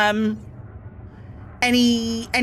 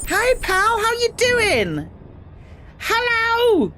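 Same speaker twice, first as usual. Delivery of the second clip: much higher, cartoonish hello; squeaky greeting voice; 13 semitones higher, much slower than usual. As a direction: greet a pal in a tiny high voice. Cheery greeting to a chat friend.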